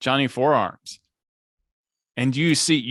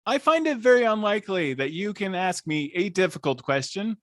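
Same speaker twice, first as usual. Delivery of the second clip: robot voice; 9 semitones higher than usual. Acted. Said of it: "she sounded like a robot".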